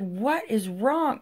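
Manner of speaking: high, drawn-out "what is wrong"; a retold worried demand, pitched well up; alarmed questioning. Mom questioning her crying, dripping-wet kid, alarmed. Mother asks crying child.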